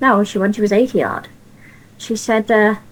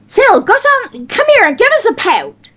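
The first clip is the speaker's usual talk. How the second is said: calling out; 11 semitones higher than usual. Retold or imitated. Gladys calling niece over.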